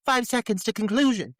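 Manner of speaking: high-pitched urgent technician imitation; mock dramatic. Podcaster as lab technician during tense standoff, mock dramatic. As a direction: announce it with hammed-up urgency. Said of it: "this unnecessary dramatic bit".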